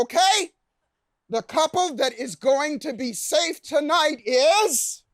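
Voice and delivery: drawn-out dramatic host announcement; mock TV host building suspense; suspenseful host. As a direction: stretch it out like a game-show host teasing the winner.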